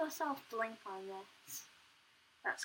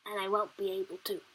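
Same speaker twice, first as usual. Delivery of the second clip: ordinary talk, nothing marked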